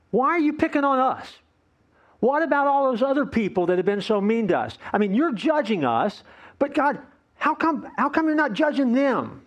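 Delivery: whiny high plea to God; mock the grumbling people, whining upward; whining complainer. The people of Israel whining to God about being picked on.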